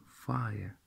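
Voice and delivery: ordinary talk, nothing marked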